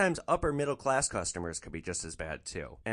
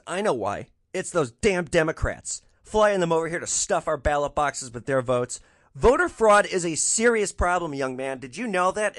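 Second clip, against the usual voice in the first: louder, lightly raised caricature of a lecturing woman; lecturing lady; 4 semitones higher, 7 dB louder than usual. Retold or imitated. Passenger lectures driver on voter fraud: loud mock lecture. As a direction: wag a finger at the young man, louder. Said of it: "So she says".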